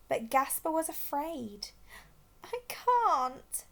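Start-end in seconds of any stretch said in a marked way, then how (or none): 2.4-3.6 much higher, scared little cry; refuse, too scared to move; frightened cry. A narrator's reading. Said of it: "he cried".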